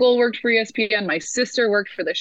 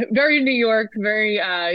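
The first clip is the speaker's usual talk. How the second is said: normal talking voice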